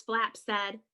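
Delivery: ordinary delivery, nothing marked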